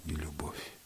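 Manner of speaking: very soft, sinking repetition; hushed echo. A narrator's reading. Lover protesting in the dark, sinking to a hush. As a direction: let the repeat drop almost away.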